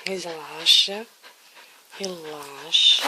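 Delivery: low, slow soothing voice; calm the frightened pup slowly; soothing relax. Real, not acted. Calming the nervous dog. (falando baixo e devagar para acalmar)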